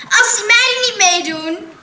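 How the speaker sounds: put-on a bratty boy shutting out the girls; cheeky boy. A narrator's reading. Boy in class sneering at the girls, high and loud.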